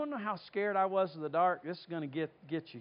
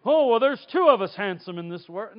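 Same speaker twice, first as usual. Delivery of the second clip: much louder mock-vain quip; joking boast; 2 semitones higher, 10 dB louder than usual. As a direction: puff up like you're handsome. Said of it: "what are you supposed to say".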